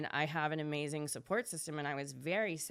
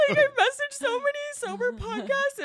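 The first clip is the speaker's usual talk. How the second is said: excited; 10 dB louder than usual. Real, not acted. Excited remembering.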